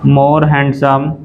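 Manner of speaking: slow repeat. Shown as a demonstration.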